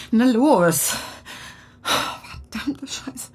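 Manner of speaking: tense, muttering and cursing; panicked trapped woman. Acted. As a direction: panic rising in the dark trunk. Trapped woman in car trunk.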